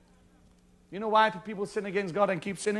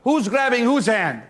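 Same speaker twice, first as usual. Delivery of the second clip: louder, pressing repeat; 4 semitones higher, 7 dB louder than usual. Shown as a demonstration. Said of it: "Come on".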